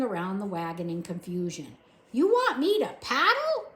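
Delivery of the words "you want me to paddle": high, loud puzzled voice; a deaf girl guessing loudly; mishearing girl. A narrator's reading. Deaf Mig mishearing the soldier, high and loud.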